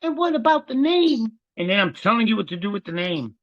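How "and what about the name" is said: high, put-on whiny student question; mocking student. Teacher mocking students asking about the name, high and put-on. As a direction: make fun of a clueless student. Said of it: "come in like".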